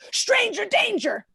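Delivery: high, drawn-out mock kid yell; slow high-pitched mock yell; yelling child. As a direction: imitate a four-year-old's alarm cry. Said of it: "he's just yelling".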